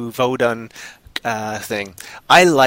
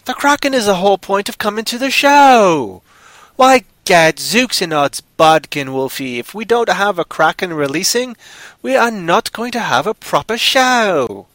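high, hammed-up British indignation; theatrical fake British, swooping pitch; fake British accent; 6 semitones higher, 4 dB louder than usual. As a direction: protest grandly in a bad British accent. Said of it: "As I use in my fake British accent".

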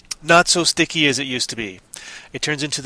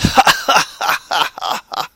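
mocking laugh; 4 dB louder than usual. Real, not acted. Teasing friend stuck at work, staccato laugh. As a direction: run of staccato ha-ha-has.

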